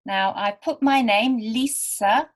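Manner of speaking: normal talking voice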